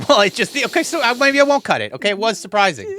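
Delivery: high, loud, laughing backpedal; embarrassed backtrack. Real, not acted. Backpedalling to co-host about cutting it, high and embarrassed. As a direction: squeak it out, embarrassed.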